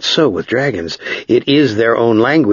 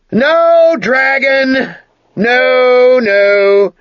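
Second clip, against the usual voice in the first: shouting; 5 dB louder, much slower than usual. Acted. Ged refuses the dragon.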